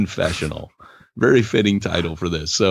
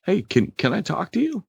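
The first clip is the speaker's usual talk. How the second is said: friendly ask; 11 semitones higher, 3 dB quieter than usual. Retold or imitated. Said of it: "he would be like".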